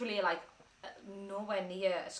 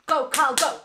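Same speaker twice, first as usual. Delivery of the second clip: rally him on like a cheerleader; loud cheer; 4 semitones higher, 12 dB louder than usual. Real, not acted. Girlfriend egging on her partner live on stream, loud cheer. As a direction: shout "Go, Carl, go!" with a big burst of volume.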